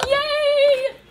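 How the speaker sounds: a high, loud squeal of joy; happy squeal. Real, not acted. Tarot reader squealing with delight at the clearing sky.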